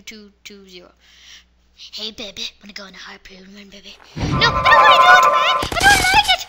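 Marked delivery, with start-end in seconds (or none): 2.0-4.0 sly dad. Pretend. Voicing the dad to his kid. 4.2-6.4 put-on high-pitched crying child, loud; child bawling that he hates harpoon runs; whiny scared kid. Kid character refuses, crying.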